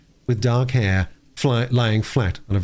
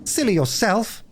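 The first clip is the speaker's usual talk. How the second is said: retort; 11 semitones higher than usual. Acted. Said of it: "retorted Phyllis".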